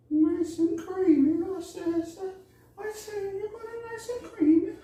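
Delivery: very high, squeaky cartoon Italian accent; bad Luigi. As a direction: badly fake an Italian game character in shrill falsetto. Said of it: "That was a bad Luigi, uh, accent".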